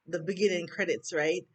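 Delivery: usual speaking voice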